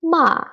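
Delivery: MA starting high, dropping fast; falling tone. Shown as a demonstration. Teacher showing the last tone, ma falling. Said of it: "going down".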